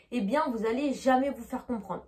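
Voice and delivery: normal talking voice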